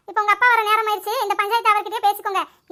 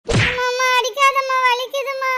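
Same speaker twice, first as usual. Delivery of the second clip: put-on tearful child begging mother to stop; crying plea; slower than usual. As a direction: whimper it as the blows land. Child being beaten begs mother.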